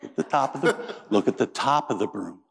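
ordinary talk

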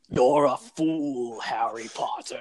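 drawn-out villain impression; Voldemort. Quoting Voldemort to tease a sister, slow villain voice. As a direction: stretch each word slowly like a movie villain. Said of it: "do it with the Voldemort accent".

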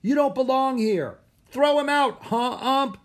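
high, loud hostile shout; mean yell from the stands; harsh, mean heckling. A narrator's reading. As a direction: jeer like a grown-up heckler. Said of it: "Their voices had grown harsh and mean".

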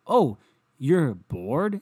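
mocking bored people, sarcastic drawl. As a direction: drag it out sarcastically.

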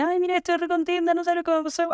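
high, bubbly mock excitement; a friend squealing over good news, half-acted; imitating happy friend. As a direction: gush like you just got great news. Friend sharing great news.

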